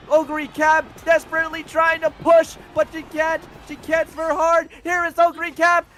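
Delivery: high, loud, climbing race call; live race play-by-play. Real, not acted. Announcer calling the final stretch to viewers, excited play-by-play. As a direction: build to a sustained high cry at the finish.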